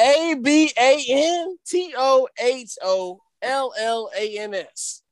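slow, letter-by-letter spelling; spelling for dictation. Real, not acted. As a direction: spell it out slowly for someone writing it down. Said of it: "Did you write it down".

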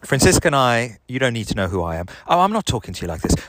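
ordinary talk, nothing marked

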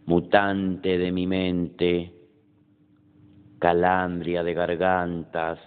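measured poem reading with pauses after each line; poetry recitation. Acted. Poet reading own poem Noche to an audience, measured recitation.

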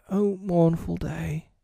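slow, high mourning moan; mournful lament.

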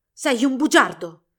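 loud, slow accusing shout; insistent accusation. A narrator's reading. Rival confronting Albert before villagers, loud insistence. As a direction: drive the accusation home loudly. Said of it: "insistette Hilarion".

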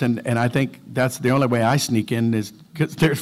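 ordinary delivery

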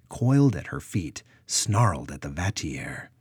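plain reading voice

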